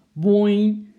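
loud, ringing cartoon boing; imitated boing. A narrator's reading. Storyteller imitates an apple hitting camping gear, loud boing. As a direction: make the impact ring out.

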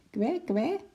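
very high happy penguin quack. Acted. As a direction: quack cheerfully at the other penguins.

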